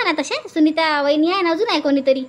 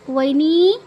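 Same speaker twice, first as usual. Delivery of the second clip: normal talking voice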